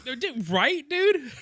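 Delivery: high-pitched disbelief; incredulous high squeal. Real, not acted. Mocking outburst.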